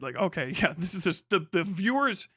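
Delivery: ordinary talk, nothing marked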